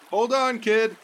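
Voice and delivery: put-on high call to the kid. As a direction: shout across the water. Bystander calls to kid.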